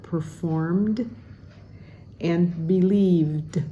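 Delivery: "performed" and "believed" with crisp final Ds, slowly; enunciated final D. Shown as a demonstration. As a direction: sound out each D at the word end. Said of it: "important to enunciate that D sound".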